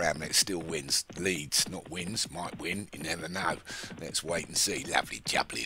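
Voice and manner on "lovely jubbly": lower, quick Cockney "lovely jubbly"; low, brisk Cockney race call; Cockney catchphrase. Pretend. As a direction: pitch it down like a market trader in Cockney. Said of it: "Maybe I should talk like Cockney".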